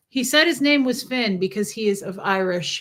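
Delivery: ordinary talk, nothing marked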